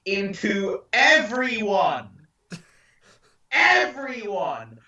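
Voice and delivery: slow, drawn-out exclamation; dramatic. Acted. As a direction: stretch it out for drama. Dramatic reveal, slowed.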